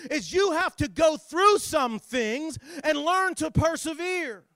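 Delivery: high, loud dramatic scripture telling; full-force preaching. Acted. Preacher telling of earthquake and fire, full-force preaching. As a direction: thunder out each disaster, stretching the key words.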